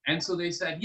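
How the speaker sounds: usual speaking voice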